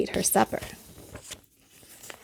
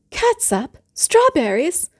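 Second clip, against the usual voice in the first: appalled disbelief; 8 semitones higher, slower than usual. Acted. Mother appalled at baby's food.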